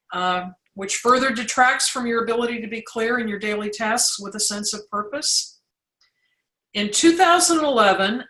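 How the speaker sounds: ordinary talk, nothing marked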